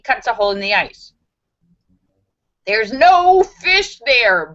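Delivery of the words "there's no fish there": booming voice. Acted. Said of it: "booms a voice".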